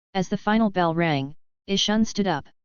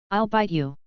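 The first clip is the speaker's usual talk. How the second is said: mock threat. A narrator's reading. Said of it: "in what was meant to be a threatening manner".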